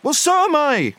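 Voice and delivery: very high, exclaiming character voice; Bilbo crying out. A narrator's reading. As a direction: cry it out, pitched far up. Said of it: "cried Bilbo".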